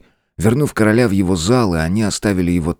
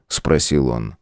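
ordinary delivery, nothing marked

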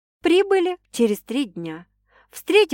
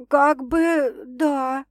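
slow, higher, trying to stay calm; forced calm; 6 semitones higher, much slower than usual. A narrator's reading. Sasha admits to her sister she's scared, slowly. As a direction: hold it together, slowly. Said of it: "стараясь сохранить спокойствие".

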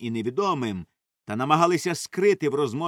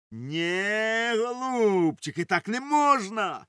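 high, slow, drawn-out reproach; drawling high-pitched rebuke; sarcastic scolding; 8 semitones higher, much slower than usual. A narrator's reading. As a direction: stretch it out mockingly. Doctor scolds them sarcastically.

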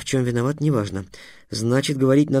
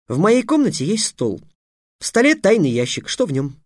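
put-on much higher boyish voice; boy explaining; 8 semitones higher, 3 dB louder than usual. A narrator's reading. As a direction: Trix describing the drawer with glee. Trix voice. (высокий мальчишеский голос)

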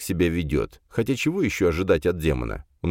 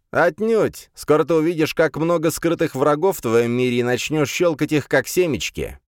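gloating demon; 8 semitones higher than usual. Acted. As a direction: promise easy kills with relish. Demon predicts enemies.

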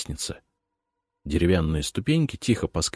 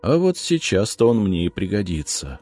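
ordinary delivery

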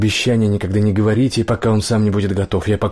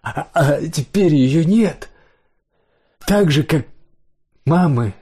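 higher, slow, halting grief; acted sobbing; 6 semitones higher, slower than usual. A narrator's reading. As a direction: stumble through the words as if sobs interrupt. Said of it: "сотрясаться от рыданий".

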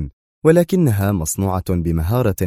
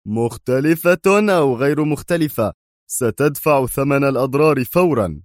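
higher-pitched, forceful demand; rough demand; 6 semitones higher than usual. Acted. Qadri demanding damages from Bruce, rough and forceful. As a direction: press for payment, aggressively. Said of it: "جذبه قدري خارج سيارته في عنف".

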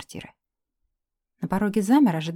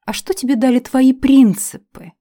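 loud, higher irritated retort; 6 semitones higher, 10 dB louder than usual. Acted. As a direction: throw it back in annoyance. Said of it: "раздражённо отозвался эльф".

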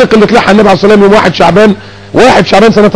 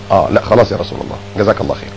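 soft, low, contented reply; humble acceptance; 11 semitones lower, 9 dB quieter, faster than usual. Retold or imitated. A companion accepting the Prophet's reason, soft and low. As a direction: settle down, satisfied and soft.

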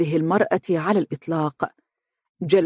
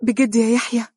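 higher, eager "بجد" burst out; eager cry; 6 semitones higher than usual. Acted. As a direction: grab at the hope. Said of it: "تهتف به".

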